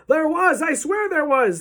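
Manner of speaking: high, loud, insistent protest; indignant high-pitched holler; shouting back. A narrator's reading. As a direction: yell it back, desperate to be believed. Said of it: "the man was shouting back".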